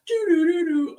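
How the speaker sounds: high, loud "doo-doo" jingle; imitated intro jingle. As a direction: sound out a news intro tune. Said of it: "it goes, like".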